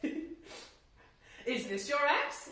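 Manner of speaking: very slow, drawn-out test question; slow sly old man. Acted. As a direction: drag it out, tempting the greedy one. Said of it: "the old man asked".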